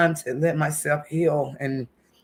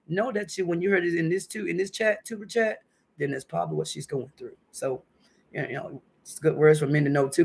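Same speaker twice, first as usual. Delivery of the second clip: normal talking voice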